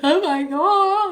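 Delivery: slow, high, loud gasp of awe; overwhelmed exclaim. Real, not acted. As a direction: let it burst out in wonder. Overwhelmed gratitude after spirit world.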